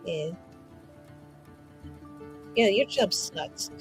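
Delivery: ordinary delivery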